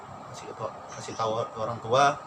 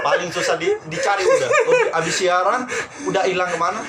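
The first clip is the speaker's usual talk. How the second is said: high, loud words through laughter; laughing hard; 12 semitones higher, 9 dB louder than usual. Real, not acted. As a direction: laugh out the teasing while talking. Teasing friend, laughing hard. (bicara sambil tertawa keras)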